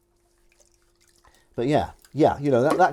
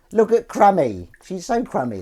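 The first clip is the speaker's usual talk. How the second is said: higher, cooing over the pet; doting; 9 semitones higher, 9 dB louder than usual. Real, not acted. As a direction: coo at the pet.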